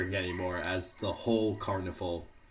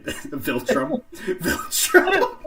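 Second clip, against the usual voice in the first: giggling through the word; laughing; 6 dB louder, much slower than usual. Real, not acted. Cracks up over the word.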